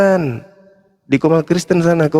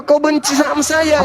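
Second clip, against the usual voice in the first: higher, disbelieving "Kenapa kau benci sama saya?"; incredulous question; 10 semitones higher than usual. Real, not acted. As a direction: ask it as if you can't believe it. Challenging the caller's hatred.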